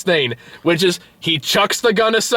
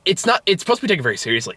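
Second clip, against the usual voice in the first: usual speaking voice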